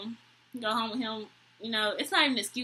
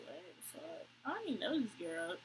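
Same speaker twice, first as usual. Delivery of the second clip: muttered disbelief; 13 dB quieter than usual. Retold or imitated. Her stunned reaction in her head.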